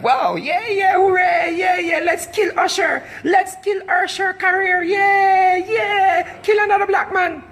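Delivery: high, shouted mock hooray; hammed-up high mob cheer; mocking cheering crowd. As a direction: jeer like a crowd cheering the takedown. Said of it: "I'm like".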